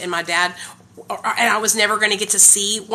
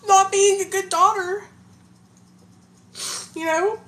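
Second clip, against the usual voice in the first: crying; 11 semitones higher than usual. Real, not acted. Daughter apologizing to her dad on his birthday, crying. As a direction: speak through real tears, voice breaking high. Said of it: "I started crying".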